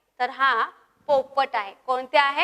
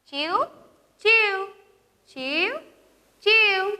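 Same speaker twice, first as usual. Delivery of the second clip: sparrow chirp; 6 semitones higher, slower than usual. Shown as a demonstration. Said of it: "चिमणी कसा आवाज करते?".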